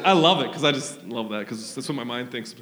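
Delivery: normal talking voice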